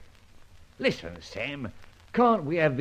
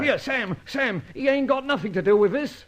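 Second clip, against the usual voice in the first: urgent plea; 9 semitones higher than usual. Acted. Urgently defends his friend.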